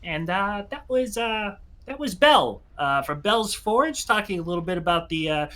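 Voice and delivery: high-pitched, hesitant imp radio host; imp announcer. Acted. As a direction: play an imp fumbling through a show link. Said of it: "an imp saying".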